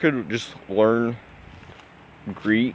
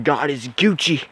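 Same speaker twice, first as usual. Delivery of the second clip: slow, higher, lightly mocking delivery of slang; mock slang Jesus; 5 semitones higher than usual. Mocking the slang Jesus would never say, higher. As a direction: drawl the slang a little mockingly. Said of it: "I doubt Jesus would have said".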